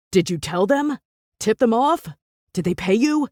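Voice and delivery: hard, accusing questions; accusing man. A narrator's reading. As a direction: accuse her, pressing. Jasper accusing Viola.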